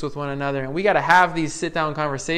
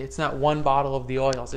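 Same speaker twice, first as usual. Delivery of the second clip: ordinary delivery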